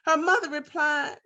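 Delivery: ordinary delivery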